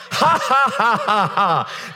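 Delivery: high, deliberate "ha ha" falling in pitch; staged laugh of derision; mocking laugh. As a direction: laugh scornfully from the pulpit. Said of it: "God sits in the heavens and laughs".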